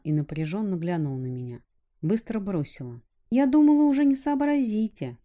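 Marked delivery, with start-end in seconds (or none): 3.3-5.2 put-on high, quick girlish retort. A narrator's reading. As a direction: toss it off fast in a girl's voice. Girl voice snaps quickly.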